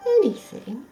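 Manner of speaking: a mild sly question. Acted. The fox slyly testing the dog, mild. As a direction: tempt him slyly. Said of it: "said the fox slyly".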